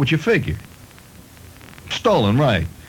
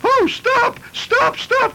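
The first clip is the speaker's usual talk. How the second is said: piercing falsetto shriek, far higher; woman's shrieking cry; much slower than usual. Acted. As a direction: shriek like a woman watching her house wrecked. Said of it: "she cried".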